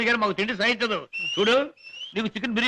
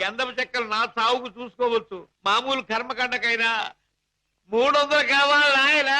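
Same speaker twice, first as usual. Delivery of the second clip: pitiful slow plea; slower than usual. Acted.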